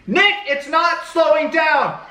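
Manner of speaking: high, slow, drawn-out alarm; mock panicked call. Rider calling to Nick on runaway horse, mock panic.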